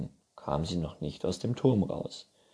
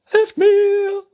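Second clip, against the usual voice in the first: very high, loud shriek; princess calling out; 15 dB louder than usual. A narrator's reading. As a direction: cry for help from a tower. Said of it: "rief die Prinzessin". (schriller Hilferuf der Prinzessin)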